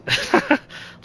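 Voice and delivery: high-pitched sudden laugh; a real squeaky laugh; burst of laughter. Real, not acted. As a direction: laugh out in surprise. Reacting to the game's message.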